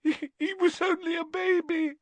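very high, stammered wail; grief-stricken high whimper; sobbing. A narrator's reading. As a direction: squeak it out through sobs. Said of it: "sobbed Lavender".

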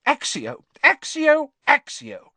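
sharp shouted incantation, peaking in the middle; loud, punchy spell-casting. A narrator's reading. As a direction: shout the summoning spell three times. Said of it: "she shouted".

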